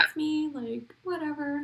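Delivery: light, high, soft shrug; quiet, breezy nonchalance; chill. Retold or imitated. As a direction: imitate a laid-back celebrity downplaying it. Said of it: "just be chill and".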